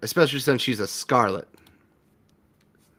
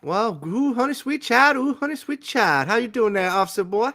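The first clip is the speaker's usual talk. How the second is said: much higher sweet-talking voice; sassy Southern waitress; 10 semitones higher than usual. Acted. Waitress sweet-talking the officer boy, high coo. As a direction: coo at the newcomer. Diner character greeting.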